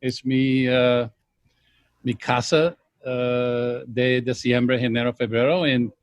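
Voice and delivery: Spanish with an American accent; careful Spanish, American accent. Real, not acted. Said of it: "perdona mi español".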